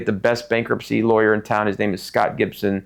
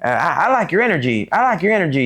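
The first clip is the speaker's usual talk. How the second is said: put-on higher sing-song voice of an older mentor figure; imitating Mister Click; 7 semitones higher than usual. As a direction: play back his catchphrase, pitched up. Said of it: "he'd always say".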